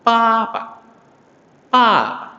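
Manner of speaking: papa high on the first syllable, light on the last; neutral tone. Shown as a demonstration. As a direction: stretch the first pa high, drop the second short. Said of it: "nada netral".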